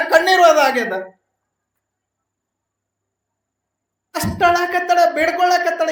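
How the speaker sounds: loud impassioned sermon, pushing each line; preaching at full force. Acted. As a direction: rouse the crowd with a loud, pleading preach.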